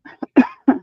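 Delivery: dry cough from the dust. Real, not acted. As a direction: cough out the dusty air. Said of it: "sorry, the dust is getting to me".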